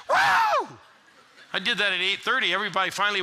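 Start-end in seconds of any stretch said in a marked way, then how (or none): 0.1-0.8 very high, loud joyful whoop. Retold or imitated. Pastor alone in his car after the conversion, whooping loud. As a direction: let out a big celebratory woo. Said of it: "I got in my car and I went".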